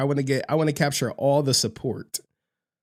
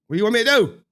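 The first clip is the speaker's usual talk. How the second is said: higher, louder retort; louder defiant question; 8 semitones higher, 4 dB louder, much faster than usual. Retold or imitated. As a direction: push back hard on "do". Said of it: "I'm like".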